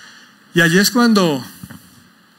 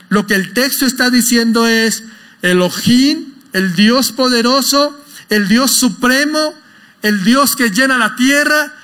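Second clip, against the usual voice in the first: higher, slower, rising preacher's proclamation; fervent preaching; 4 semitones higher, slower than usual. Acted. Pastor proclaiming God's power to the church, fervent preaching. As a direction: lift it up and proclaim it to the congregation. Preacher builds to full force.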